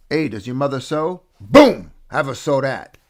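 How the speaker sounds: loud punchline on Boom; light Christopher Walken imitation; Joe Dirt janitor quote. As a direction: quote the mobster janitor with a bang. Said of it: "The janitor from Joe Dirt".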